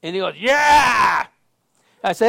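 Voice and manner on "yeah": high, loud shouted holler; imitated scream. Homeless man screams back up at the pastor, imitated shouting. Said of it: "he's always screaming".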